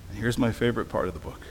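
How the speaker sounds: ordinary delivery, nothing marked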